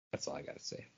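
breathy whispered sign-off; whispered aside. Real, not acted. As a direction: drop to a whisper to close the rant. Closing aside after rant.

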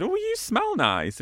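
high, slow, sing-song flirt; wife cooing a compliment across the hall; mock flirty compliment. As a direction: tease him sweetly so mum hears. Wife flirting to provoke mum.

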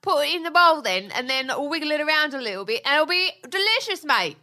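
high-pitched chatty British chef voice; Jamie Oliver impression. Wife explains recipe on request, mock British chef voice. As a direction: play a matey British TV cook. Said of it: "Can you do it in a Jamie Oliver voice?".

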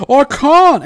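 high, loud helpless yell; acted panic, shouted high; panicked cry. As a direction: cry out in alarm as you lose control. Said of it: "And he goes".

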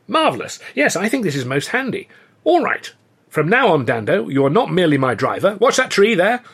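lightly voiced English officer, pleased and rising; officer thrilled by his lucky find; a hint of a chuffed upper-class captain; delighted captain. A narrator's reading.

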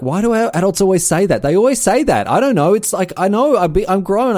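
put-on high, whiny kid complaint. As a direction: play a confused complaining kid. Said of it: "they're like".

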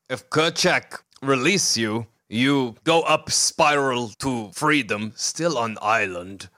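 slow, deliberate simple creature; Kerchak's halting speech. Acted. Offering a spiral to freedom, slow halting creature. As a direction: spell out the plan slowly in broken English.

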